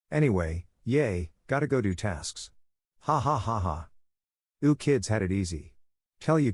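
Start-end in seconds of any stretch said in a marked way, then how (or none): 3.0-3.9 mock laugh. Mocking laugh.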